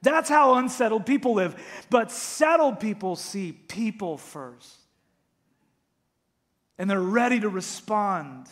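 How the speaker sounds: loud, high, urgent sermon delivery; full-force preaching. Acted. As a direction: preach it out to the whole room. Pastor preaching to congregation.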